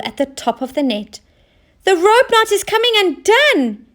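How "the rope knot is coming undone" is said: high alarmed cry. Acted. Oscar spotting the hole in the net, high alarmed cry. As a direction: sound the alarm. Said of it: "he cried".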